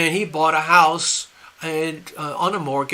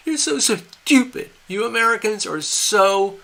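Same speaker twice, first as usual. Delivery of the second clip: mocking derision. Leader laughing at gullible Americans, mocking gloat. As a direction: jeer at the fools you have tricked. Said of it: "they're laughing at us and going".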